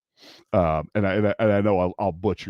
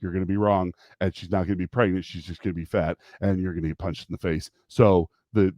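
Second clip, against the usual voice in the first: ordinary talk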